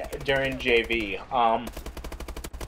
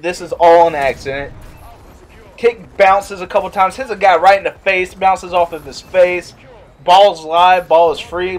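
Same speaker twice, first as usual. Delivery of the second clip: ordinary talk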